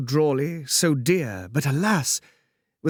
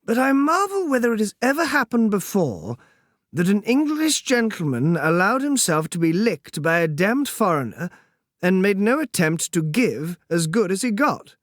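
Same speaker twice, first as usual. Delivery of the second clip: higher, amused foppish voice; languid dandy; 6 semitones higher than usual. Acted. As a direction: laugh off the beating like a lord. Percy's voice held.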